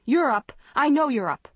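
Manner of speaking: high, loud accusation; accusing shriek. A narrator's reading. Girl at sister feigning sleep, shriek. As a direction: shriek it, pitch leaping up. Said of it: "Maggie shrieked".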